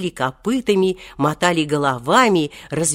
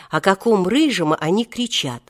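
usual speaking voice

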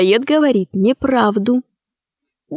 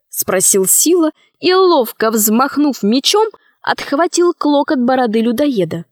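ordinary delivery

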